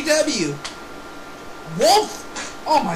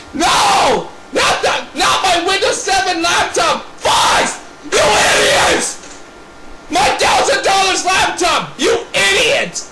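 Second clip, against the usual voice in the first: frantic shouting over a wrecked laptop; outraged yelling; 2 semitones higher than usual. Pretend. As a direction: yell at the idiot who broke it. Laptop wrecked, yells at culprit.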